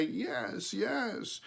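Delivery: high crow-like yes; chirpy high yes, yes; crow saying yes. A narrator's reading. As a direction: answer like the little crow would. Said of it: "as much as to say".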